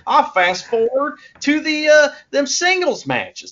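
high, slow mock American fan drawl. Co-host mocking American fans in high drawl. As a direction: mimic a whiny American wrestling fan. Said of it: "That's exactly how they sound".

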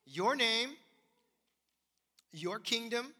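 "your" pushed high and strong in each phrase; stress on your. Shown as a demonstration. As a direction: hit the word "your" as you list them. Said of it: "Let me point that out".

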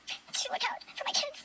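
breaking into a soft, choked whisper; words fading into tears; upset whisper. Real, not acted. Mother ending her speech to the principal, whispered. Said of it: "Oh, this is so upsetting".

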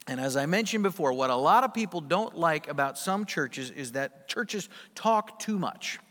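ordinary talk, nothing marked

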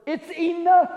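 "it's enough" rung out high and loud; emphatic declaration. Shown as a demonstration. Restates the emphatic word forcefully.

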